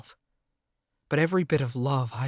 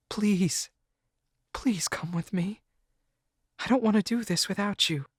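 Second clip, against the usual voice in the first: higher, half-whispered plea; begging girl; 7 semitones higher than usual. A narrator's reading. Girlfriend begging the narrator to come, high and hushed.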